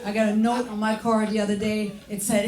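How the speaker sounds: normal talking voice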